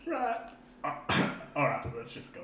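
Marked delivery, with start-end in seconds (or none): 0.1-0.5 falsetto lady voice. Acted.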